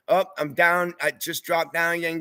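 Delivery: ordinary talk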